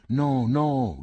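put-on high shouted no from a panicked boy; shouted denial. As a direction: cry out no, no in desperate denial. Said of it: "gridò Cinto".

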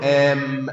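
آم with long vowel and held m; doubled m. Shown as a demonstration. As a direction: hold the closing m of آم, aamm. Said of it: "وهذا خطأ". (آمّ بميم مشددة)

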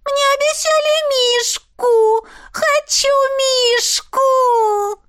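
очень высоко, медленно, хнычет; ноющая капризная девочка; капризный ребёнок. Acted. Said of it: "капризный детский голос". (девочка капризно требует мишку, хныча)

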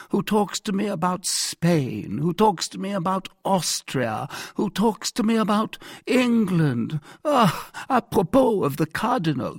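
complaining king. Acted. King grumbling to his captain about the cardinal, complaining. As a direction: lament like a put-upon king, turning vexed. King laments falconry, then shows vexation.

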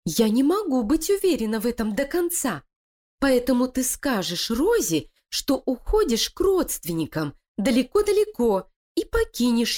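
high, sly voice of a plotting wife; scheming female voice. Acted. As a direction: persuade her partner, cold and cunning. Лилия угрожает крысе. (коварная интриганка)

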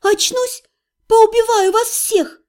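high-pitched threat, slowing down; threatening. A narrator's reading. Patient drifting under a spell, high slowing threat. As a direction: threaten them in a high, protesting voice. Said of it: "я пригрозила".

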